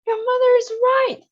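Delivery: high loud shout. A narrator's reading. Father shouts at daughter backing the mother, loud. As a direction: shout agreement in alarm. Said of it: "shouted Mister Beauregard".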